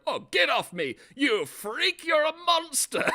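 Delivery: high, loud, hammed-up screech of a man insulted; mocking shrill outburst. Mocking the husband who lost a fight, high shrieking. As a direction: shriek it like a wounded brat. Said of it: "he literally stands up and he's like".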